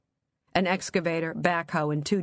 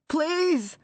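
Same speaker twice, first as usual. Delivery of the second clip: screaming plea; 12 semitones higher than usual. Acted. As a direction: scream for mercy. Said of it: "Walter screamed".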